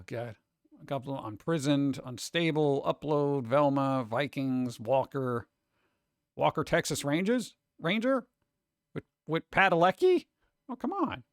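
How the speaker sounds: normal talking voice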